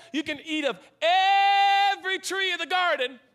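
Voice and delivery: every stretched out long, high and loud; stress on every. Real, not acted. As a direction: draw out every in a booming high call. Hammers home the verse's permission.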